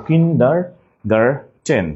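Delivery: very slow "kindergarten" in four pieces, ending -ten; kin, der, gar, ten: split "kindergarten" with pauses; syllable by syllable. Shown as a demonstration. Teacher correcting class, "kindergarten" slowly split, T in last syllable. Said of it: "it is not garden".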